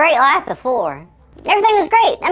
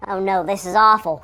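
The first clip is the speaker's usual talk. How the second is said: ordinary delivery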